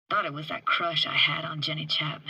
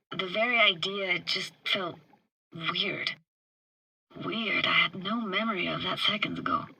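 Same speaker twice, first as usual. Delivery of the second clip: ordinary delivery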